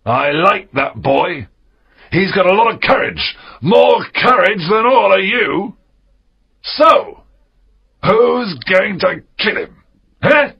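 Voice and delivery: high, loud, forceful dare; challenging pirate captain. Acted. Silver defends Jim before the pirates, loudly challenging. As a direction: defend the boy and dare the crew.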